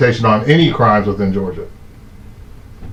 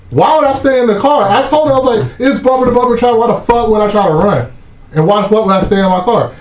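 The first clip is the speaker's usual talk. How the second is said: higher, heated, faster at the end; retold yelling back; 8 semitones higher, faster than usual. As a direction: lay into the cop in disbelief. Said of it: "So I'm yelling at these guys".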